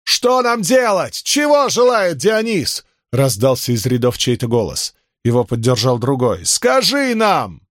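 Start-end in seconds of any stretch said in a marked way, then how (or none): 0.0-2.9 high, slow voice from the crowd; anxious crowd voice. Acted. Voice from crowd asks. 6.5-7.7 high, slow shout from the ranks; crowd shout. Acted. Crowd demands answer.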